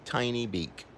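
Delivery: ordinary delivery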